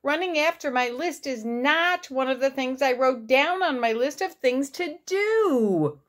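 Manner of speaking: high-pitched whiny storybook complaint; wail. A narrator's reading. Toad explaining to Frog why he can't run, wailing. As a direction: keep wailing to the last word. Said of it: "wailed Toad".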